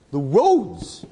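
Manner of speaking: the roads rising high and loud with wonder; excited promise. Retold or imitated. Sailor luring the poor man aboard, excited. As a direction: sell the dream of the diamond island.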